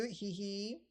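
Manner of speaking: a little high-pitched giggle; high sword giggle. Acted. The sword Sirith giggling at Pike. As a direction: giggle like a mischievous blade. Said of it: "My name is Sirith".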